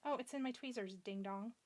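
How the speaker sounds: ordinary talk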